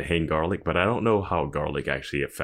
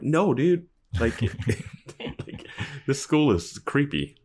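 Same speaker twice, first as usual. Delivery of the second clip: creeped out; 9 semitones higher, slower than usual. Retold or imitated. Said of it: "I'm like".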